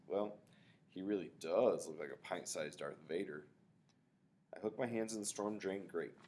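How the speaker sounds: plain reading voice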